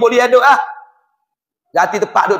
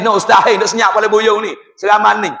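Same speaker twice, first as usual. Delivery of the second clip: ordinary talk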